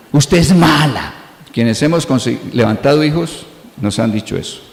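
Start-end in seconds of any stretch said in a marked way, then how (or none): 0.1-1.1 slow, lightly voiced toddler accusing mom; imitating a small child. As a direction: mimic a little kid drawing it out. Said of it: "¿Alguna vez le dijeron eso o no?". (niño pequeño acusando a la mamá, lento y medio imitado)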